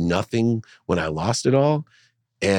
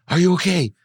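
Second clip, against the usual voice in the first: much higher, anxious mom; mother's worried question; 12 semitones higher, 5 dB louder than usual. Retold or imitated. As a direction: plead like a frightened mother. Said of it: "She's like".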